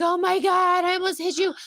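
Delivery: high, startled "oh my God"; shocked gasp. Retold or imitated. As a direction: blurt it out in shock. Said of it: "I was like".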